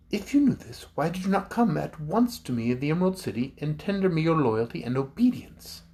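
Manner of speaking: put-on high, firm girlish demand; young ruler Ozma. A narrator's reading. Ozma demands the chief's loyalty, high and dignified. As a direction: question him with royal dignity. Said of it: "asked the ruler of Oz".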